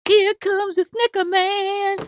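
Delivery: very high, slow cartoonish sing-song squeal; mock the fans' catchphrase in a silly squeaky voice; mocking quote. Vlogger mocking what his fans love to say, high silly squeal. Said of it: "you think it's fun to say".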